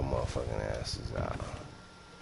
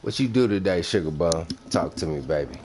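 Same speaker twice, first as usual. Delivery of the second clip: loud, higher mock-demanding street voice; 3 semitones higher, 6 dB louder than usual. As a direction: bark it at the chat like an impatient homie.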